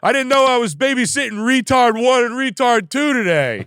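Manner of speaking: very high, loud mocking put-on voice; old carpenter Howard insulting the framers, shrill and loud; Howard's insult. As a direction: sneer it in a squeaky jeer. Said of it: "That's what he'll say".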